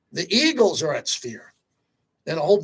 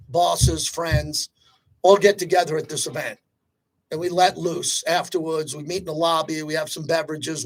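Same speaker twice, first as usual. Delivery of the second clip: ordinary delivery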